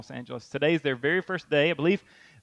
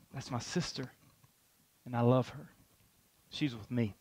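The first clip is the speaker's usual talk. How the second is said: tender; 5 semitones lower, 10 dB quieter than usual. Acted. Jesus voiced claiming us softly.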